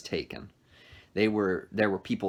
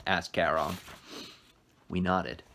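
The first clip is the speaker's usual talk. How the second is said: ordinary delivery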